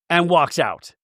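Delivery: ordinary talk, nothing marked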